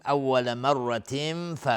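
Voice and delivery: chanted "مرة" as marratin, the n hidden in a hum before f; ikhfa. Shown as a demonstration. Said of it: "تنوین بعدش فا اخفای حقیقی". (اخفای تنوین «مرة» پیش از فا)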